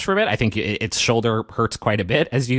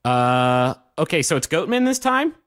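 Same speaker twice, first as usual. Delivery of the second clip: surprised; 7 semitones higher than usual. Acted. GM voices NPC Barclay surprised.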